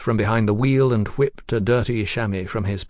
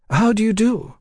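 much higher genteel greeting; lady's voice; 11 semitones higher, 4 dB louder than usual. Acted. Lady Ada greeting the stranger, much higher. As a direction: greet her like a grand lady.

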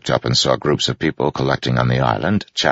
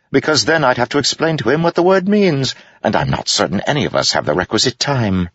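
mocking jester; 13 semitones higher than usual. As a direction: savor the put-down. Wit insults.

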